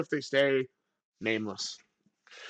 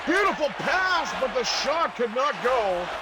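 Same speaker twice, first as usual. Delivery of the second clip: high, stretched-out call of a chance; excited play-by-play; 6 semitones higher than usual. Real, not acted. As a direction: pitch leaps up, easing as the shot misses. Live call of a missed shot.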